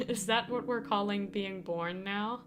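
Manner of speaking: usual speaking voice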